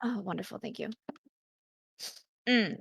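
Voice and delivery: usual speaking voice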